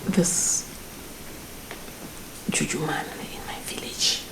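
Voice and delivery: hushed conspiratorial whisper; unvoiced secretive whisper. Secret suggestion of juju man.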